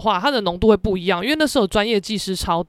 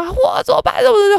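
mock panic; 10 semitones higher, 4 dB louder than usual. Panicked late-night post.

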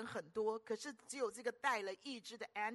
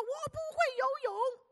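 high, slow, loud wail; a desperate shout from the water; panicked cry for help; 9 dB louder, much slower than usual. Retold or imitated. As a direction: cry out like a drowning man. Drowning driver cries for help.

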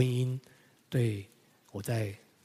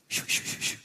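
whooshing sound. Retold or imitated. Sound of talks going deep.

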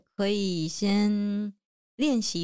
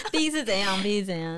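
laughing; 3 dB louder than usual. Real, not acted. Teasing about blood type.

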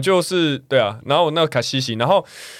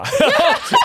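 a squealing high laugh, loud; high burst of laughter; 5 dB louder than usual. Real, not acted. Laughing loudly while telling a friend the market story.

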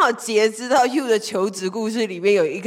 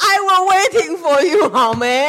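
laughing tease; 6 semitones higher, 5 dB louder than usual. Real, not acted.

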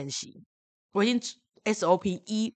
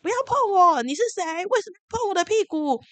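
put-on higher and louder yell for a child to copy; loud demo of a kid protesting; 12 semitones higher, 7 dB louder than usual. Shown as a demonstration. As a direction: show the child how to shout back. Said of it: "他可以说".

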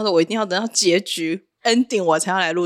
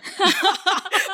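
quick high-pitched giggle; 12 semitones higher than usual. Real, not acted. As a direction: giggle helplessly at the idea. Laughs about becoming a grandma.

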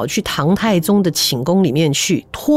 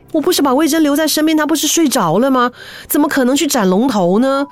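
panicked disbelief; 6 semitones higher than usual. Acted. Emperor wakes terrified from nightmare.

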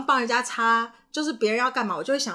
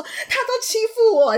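much higher, louder whine; mock crybaby complaint, higher and louder; whiny tattling; 13 semitones higher, 7 dB louder than usual. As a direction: tattle like a sulking child. Child crying for attention.

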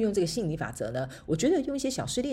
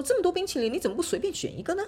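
a lightly voiced friend, pitched much higher; 11 semitones higher than usual. She imitates her friend urging her to pick one, pitched high.

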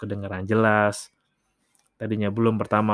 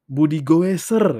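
louder, higher, slow proclamation of Budi Goeser; proud nickname; 6 semitones higher, 9 dB louder, slower than usual. Shown as a demonstration. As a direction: announce Budi Goeser like a title. Naming a contact, proclaimed.

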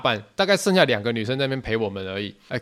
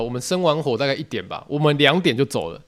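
ordinary talk, nothing marked

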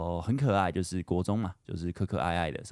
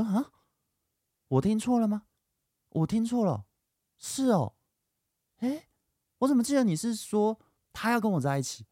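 much higher, bewildered squeak of his younger self; stunned confusion. Retold or imitated. Teenage self told he misheard, high and stunned. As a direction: sound like the floor just dropped.

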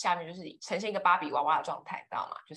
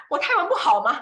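exasperated disbelief; 9 semitones higher, 9 dB louder than usual. Retold or imitated. Stunned at the colour question.

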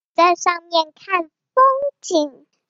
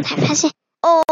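usual speaking voice

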